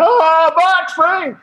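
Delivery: high, loud, slow mock announcement; hammed-up carnival shout. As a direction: fling it out like a showman. Tossing vials to crowd.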